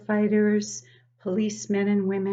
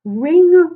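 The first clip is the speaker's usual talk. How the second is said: loud, high ringing call; shouted alarm ring; 9 semitones higher, 16 dB louder than usual. Acted. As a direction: ring out like a fire bell. Alarm sound imitated in story.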